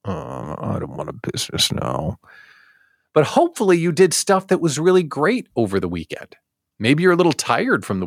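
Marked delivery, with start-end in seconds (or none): 0.1-2.2 soft, breathy groan of reluctance; whispered, much softer mock despair; weary Monday dread. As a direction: sigh it out like dreading the workweek. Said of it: "you're waking up on Tuesday thinking".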